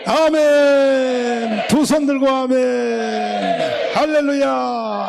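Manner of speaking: long, drawn-out revival-preacher shouts; full-voiced praise cries held long; rallying shout. Real, not acted. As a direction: call out loud to rouse the congregation. Preacher rouses congregation to respond.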